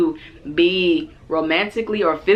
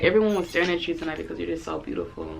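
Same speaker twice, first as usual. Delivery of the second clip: soft, quick, slick flattery imitating him; hushed mock-charming date voice; smooth compliment; 6 dB quieter, faster than usual. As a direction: rush the compliment like a practised line. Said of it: "and he was like".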